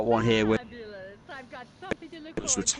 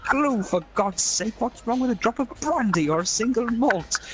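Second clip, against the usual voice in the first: high incredulous snort; outraged major; 10 semitones higher than usual. Retold or imitated. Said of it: "major scolding glue sniffers".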